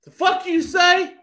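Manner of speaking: high, loud, slow snap; angry wife. Retold or imitated. Wife confronting a heckler, sharp loud angry retort. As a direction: fire it back like an offended wife. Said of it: "my wife goes".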